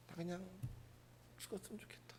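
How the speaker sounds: unvoiced despairing whisper. Preacher voicing a weary person wishing to die. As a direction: breathe it out hopelessly. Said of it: "힘이 든 사람은요".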